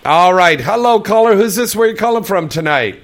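big on-air host voice; radio host announcer. Acted. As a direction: boom it out like a late-night call-in host. Host takes next radio call.

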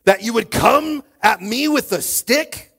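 slow, loud, high-pitched roar. A narrator's reading. Goliath scorning David's stick, roaring. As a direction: bellow it as an outraged giant. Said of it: "he roared at David".

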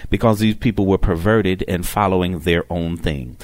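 usual speaking voice

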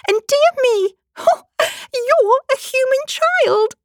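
very high exclamation, slowing on the discovery; gasp, then marvel in a high fawn voice; delighted astonishment. A narrator's reading. Fawn discovering Alice is human, astonished delight. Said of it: "cried out in a voice of delight".

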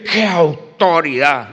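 slow, drawn-out admiring gasp of qué autoridad; mock the impressed churchgoer, savouring each word; awed admirer. A churchgoer, wowed by a shouting preacher, slowly marvels. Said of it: "Y dice".

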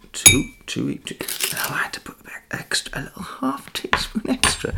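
hushed ASMR talk; whispered ASMR. Acted. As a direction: whisper it close to the mic. Whispered cooking chat.

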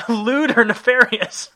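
slow, high, incredulous echo through laughter; amused disbelief. Real, not acted. Host echoing the listener's funny wording, laughing disbelief. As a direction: laugh out the words, high, loud and drawn out.

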